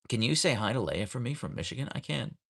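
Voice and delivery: ordinary talk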